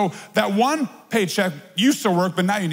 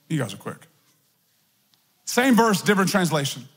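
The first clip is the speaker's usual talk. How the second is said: ordinary delivery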